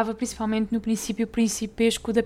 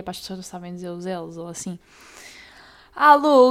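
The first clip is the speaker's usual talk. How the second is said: usual speaking voice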